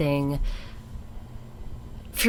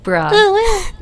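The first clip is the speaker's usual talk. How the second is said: put-on high anime girl bruh; 9 dB louder than usual. Shown as a demonstration. Mock exasperation after her voice demo.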